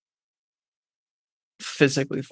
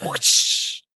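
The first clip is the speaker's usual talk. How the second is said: whoosh sound; 3 semitones lower than usual. Pretend. Mimics shooting a web.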